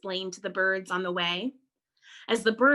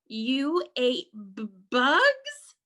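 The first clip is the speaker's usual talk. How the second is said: shocked stutter; 3 semitones higher, 4 dB louder, slower than usual. Acted. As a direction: stutter in disbelief, voice rising. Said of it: "stuttered one".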